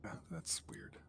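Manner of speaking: unvoiced whisper, low and soft. Real, not acted. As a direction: breathe it out as an aside. Repeats remark in whisper.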